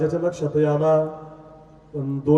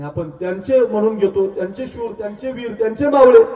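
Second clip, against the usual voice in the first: rousing build; 6 semitones higher, 5 dB louder than usual. Real, not acted. Preacher rallying youths as the king's soldiers, rousing build. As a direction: voice climbs higher, ending on a loud peak.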